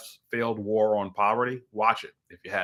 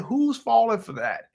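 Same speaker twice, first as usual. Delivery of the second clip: high, slow disbelieving question; incredulous; 12 semitones higher, slower than usual. Retold or imitated. Husband watching a documentary with his wife, incredulous. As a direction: draw out the retold disbelief. Retold disbelief at pimp's claim.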